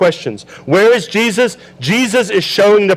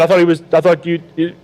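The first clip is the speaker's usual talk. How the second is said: quick, stumbling excuse from a caught husband; flustered husband; faster than usual. Retold or imitated. Joseph stammering to Mary mid-argument, quick and flustered. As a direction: fumble for words like Joseph caught out. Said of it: "marriage fight just happening right here".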